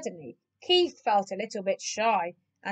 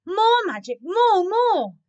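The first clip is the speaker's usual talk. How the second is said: high, loud demand; excited cry; 8 semitones higher, 5 dB louder, slower than usual. A narrator's reading. The cats wanting more magic, excited. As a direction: cry out eagerly for an encore. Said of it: "the cats cried excitedly".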